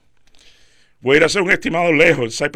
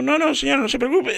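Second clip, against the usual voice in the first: higher, squeezed out while holding breath in; holding his breath; 9 semitones higher than usual. Retold or imitated. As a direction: talk with your lungs held full to look slimmer. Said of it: "inhalo bien fuerte para estar más flaco". (voz aguantando la respiración, apretada y más aguda)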